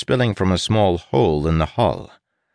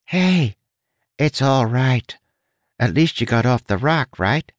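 lightly pinched, higher voice, easing down; pinched rat, consoling; 7 semitones higher than usual. Acted. Rat comforting a crying prisoner, lightly pinched. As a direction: console in a slightly squeezed high voice.

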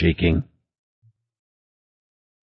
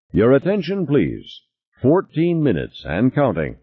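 intercom announcer; 7 semitones higher than usual. A narrator's reading. Habitat intercom announces countdown.

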